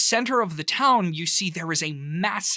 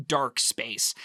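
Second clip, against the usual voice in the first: usual speaking voice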